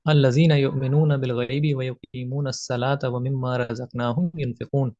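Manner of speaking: lightly intoned Arabic verse recitation; Quranic recitation. Shown as a demonstration.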